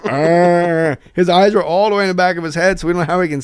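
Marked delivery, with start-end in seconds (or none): 0.1-1.0 mock zombie growl, higher and louder; retold zombie groan. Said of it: "He's just going".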